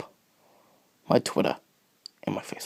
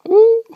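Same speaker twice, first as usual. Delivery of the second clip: mock ooh; 13 dB louder than usual. Spooky reaction after Jesus.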